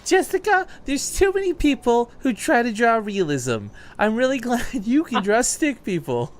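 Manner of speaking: high, slow doting mom. Retold or imitated. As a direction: gush like a proud mother. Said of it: "My mom's always like".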